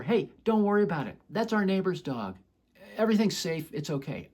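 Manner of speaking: loud call from across the park; yelling over. Retold or imitated. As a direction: shout the reassurance from far away. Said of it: "If I just yell over".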